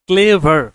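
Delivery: Clever loud, in two distinct beats; two-syllable demonstration. Shown as a demonstration. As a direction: say clever firmly as two syllables, ক্লে-ভার. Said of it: "একবার উচ্চারণ করা যায় না".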